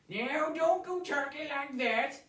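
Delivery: high, loud, slow folksy protest; a horrified backwoods codger pleading; shocked old woodsman. Acted. Rancid horrified the boy wants a job, loud and slow. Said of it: "Rancid said as soon as he had recovered from the shock".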